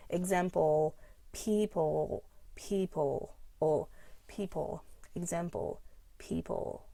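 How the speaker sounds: example and people with heavy dark L endings. Shown as a demonstration. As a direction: pull the tongue back at the end of people. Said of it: "practicing the dark L".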